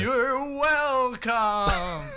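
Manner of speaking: high, drawn-out eerie ghostly voice. Acted. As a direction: moan it like a spooky ghost. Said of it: "that's a ghost voice".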